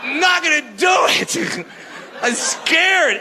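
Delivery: high, hammed-up fearful whine; scared refusal. Man refusing his fighter girlfriend's request, high mock-scared voice. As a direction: refuse in a comic panic.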